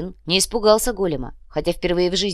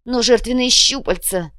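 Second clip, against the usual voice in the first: high, loud desperate protest; overwrought, high-pitched cry; breaking voice; 6 semitones higher, 6 dB louder than usual. A narrator's reading. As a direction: protest in panic, voice about to crack. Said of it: "срывающимся голосом начал Чуд".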